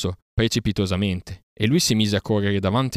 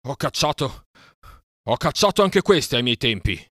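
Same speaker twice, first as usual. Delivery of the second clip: panting; 6 semitones higher, 5 dB louder than usual. Acted. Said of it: "ansimando nel polverone".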